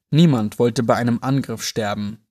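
plain reading voice

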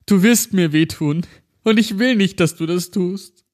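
high, frightened sobbing plea; holding back sobs. Acted. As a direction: cry it out, begging. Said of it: "schluchzte er".

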